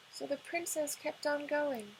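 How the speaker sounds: ordinary delivery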